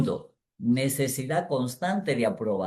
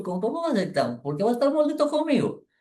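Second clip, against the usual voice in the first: can't believe it, voice climbing high; retorting to his wife in disbelief; incredulous retort; 11 semitones higher, faster than usual. Retold or imitated.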